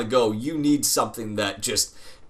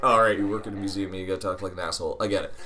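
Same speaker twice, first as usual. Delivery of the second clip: fast, low, much softer sarcastic mumble; sarcastic aside; 6 semitones lower, 3 dB quieter, much faster than usual. Retold or imitated. Kid grumbling about the museum worker's snooty talk, sarcastic and soft. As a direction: brush it off under your breath, quickly. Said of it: "and I was like".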